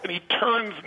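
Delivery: ordinary delivery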